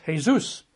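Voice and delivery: Jesus said the Spanish way, hay-ZOOS; Spanish pronunciation. Shown as a demonstration. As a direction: say Jesus as "hay-SOOS", stress at the end. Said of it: "In Spanish, it's Jesus".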